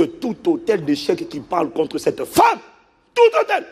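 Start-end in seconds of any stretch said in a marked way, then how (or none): 2.2-3.6 loud, high commanding cry; forceful prayer. Retold or imitated. Pastor reenacting his prayer over the woman, loud high cry. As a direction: proclaim it with force.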